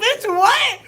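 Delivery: high, loud shocked yell through laughter; shocked shout. Retold or imitated. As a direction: yell it out in disbelief. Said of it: "I was like".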